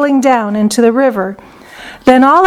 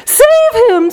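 high, drawn-out panicked scream, volume held back; 12 semitones higher than usual. A narrator's reading. Mother sees her son fall in, screaming. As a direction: cry for rescue in a high frantic voice. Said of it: "screamed Missus Gloop".

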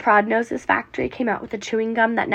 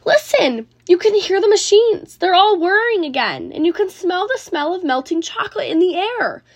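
higher, louder eager crowd call; excited shouting; 8 semitones higher, 4 dB louder than usual. A narrator's reading. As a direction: urge everyone to listen. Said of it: "the people shouted".

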